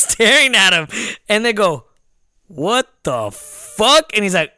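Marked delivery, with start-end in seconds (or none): 2.5-4.1 very slow mock what-the-fuck; drawn-out disbelief. Car full of strangers to the intruder, slow imitated disbelief. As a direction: stretch each word out, imitating stunned strangers.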